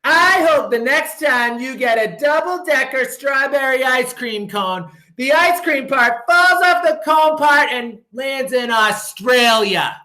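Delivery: high, slow drawn-out spiteful wish; spiteful curse. Acted. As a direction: drag out the nasty wish. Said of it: "I said to Paul".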